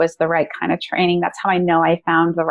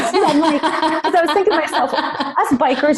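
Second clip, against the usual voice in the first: laughing; 8 semitones higher than usual. Real, not acted. Laughing at her own mistake.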